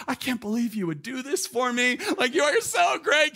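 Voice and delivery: high, loud, overflowing thanks; gushing gratitude. Retold or imitated. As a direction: gush at her like she saved you. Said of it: "gushing over this woman".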